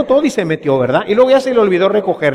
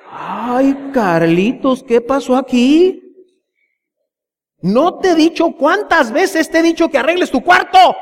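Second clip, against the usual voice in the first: exasperated mom scolding; 5 semitones higher than usual. Retold or imitated. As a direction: scold a messy child in disbelief. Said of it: "la mamá abre el cuarto".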